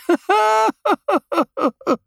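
high, loud doubled-over laugh; high belly laugh. A narrator's reading. Geert doubles up laughing at the water bike. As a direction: let the laugh spill out. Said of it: "ligt dubbel van het lachen".

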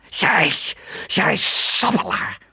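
high, sputtering, hissed rage; seething, drawn-out hissing; furious hiss. A narrator's reading. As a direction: hiss the insult slowly. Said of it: "siste hij woedend".

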